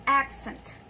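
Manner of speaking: "accent" stressed on the first syllable; "accent" as a noun, front-stressed. Shown as a demonstration. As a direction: hit the first syllable of "accent", let the second drop. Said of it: "stress is in the first syllable".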